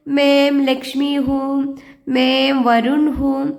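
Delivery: slow, clear model sentence for pupils. Shown as a demonstration. Teacher models a self-introduction, slowly and clearly. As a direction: say it slowly so the class can repeat. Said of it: "നിങ്ങൾ നിങ്ങളുടെ പേര് പറഞ്ഞോളൂ".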